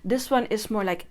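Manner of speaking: ordinary talk, nothing marked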